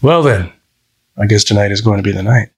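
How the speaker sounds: understated mischief in a suitor's voice; quick, lightly mischievous reply. A narrator's reading. Date hints at the night ahead, mildly mischievous. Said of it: "Ben replied with a mischievous wink".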